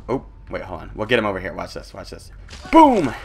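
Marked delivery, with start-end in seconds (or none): 2.7-3.1 loud, high victory shout; triumphant yell. Real, not acted. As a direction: yell it as the trap springs.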